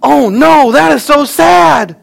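put-on very high, loud, slow little-girl voice; little daughter. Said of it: "one of my daughters says".

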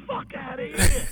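high, loud, drawn-out disbelief; incredulous shout. Retold or imitated. Writer learning his pitch sold, incredulous shout. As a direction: re-enact a stunned shout, stretched out. Retold disbelief at good news.

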